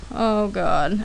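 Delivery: usual speaking voice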